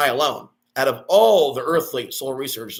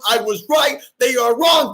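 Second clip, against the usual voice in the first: much higher, loud chanted shout; triumphant shout; 7 semitones higher, 6 dB louder than usual. Real, not acted. Gloating at critics who doubted him, shouting. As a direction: hammer each line like a victory chant.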